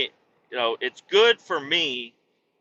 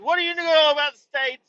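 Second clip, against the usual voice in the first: playful mock question; 13 semitones higher, 4 dB louder than usual. Voicing himself asking Canadians.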